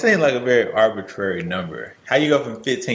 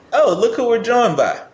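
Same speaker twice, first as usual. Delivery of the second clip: mock surprised; 10 semitones higher than usual. Real, not acted. Greeting a late arrival.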